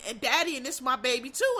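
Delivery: usual speaking voice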